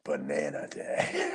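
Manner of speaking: low, slow zombie drone; deep, dragged-out zombie voice. Retold or imitated. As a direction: intone it slowly like a zombie. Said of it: "almost like in a zombie-like voice".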